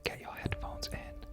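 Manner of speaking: ordinary delivery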